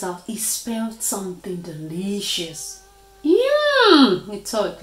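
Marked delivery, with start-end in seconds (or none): 3.2-4.3 high-pitched, hungry exclamation; hungry cartoon-dog delight; eager yum. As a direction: savour it on a high, louder note. Dog smells delicious food.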